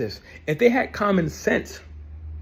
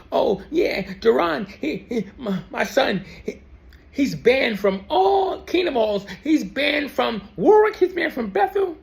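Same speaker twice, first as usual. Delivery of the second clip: mimicking mom, higher pitched and breathless; mocking mom; 8 semitones higher than usual. Son mocks his mom gossiping about him to a friend, mimicking. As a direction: quote mom like a gossiping witness.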